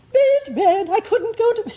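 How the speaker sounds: breathless high soprano; gasping, sung-up high; out of breath. Shown as a demonstration. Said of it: "you just have to go".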